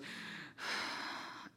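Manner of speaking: weary sigh. Retold or imitated. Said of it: "Katie looks down at him and goes".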